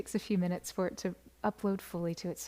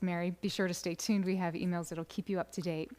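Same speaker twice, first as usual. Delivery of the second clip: ordinary delivery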